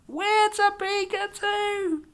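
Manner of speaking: very high, slow chorus voice; calling out together. Acted. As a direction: sing it out all together. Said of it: "all at once called out".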